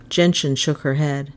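plain reading voice

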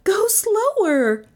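high, drawn-out cry of protest. A narrator's reading. Passenger urging the driver, high cry. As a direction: cry out high, stretching the words. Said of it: "Melissa cried".

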